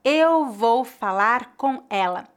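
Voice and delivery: slow and clear, every R said; slow careful. Shown as a demonstration. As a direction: articulate each word carefully. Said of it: "Por exemplo".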